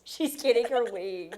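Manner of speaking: laughing through the words. Real, not acted. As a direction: giggle it out.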